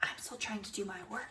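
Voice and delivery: whispered classroom rebuke; very soft, under the breath; hushed complaint. A narrator's reading. May shushes Junie B while working, whispered.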